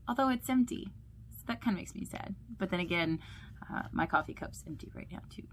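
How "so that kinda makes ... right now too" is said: plain reading voice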